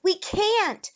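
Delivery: high, loud cry of alarm; panicked protest. A narrator's reading. Julie answers Eric under attack, loud panicked cry. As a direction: call back in alarm.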